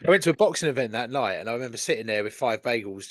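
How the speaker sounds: normal talking voice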